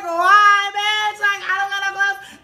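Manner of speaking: loud, high-pitched mock protest; exasperated wail. Caught naked, answering the woman at the door, exasperated wail. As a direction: wail it out in disbelief. Acted protest while undressed.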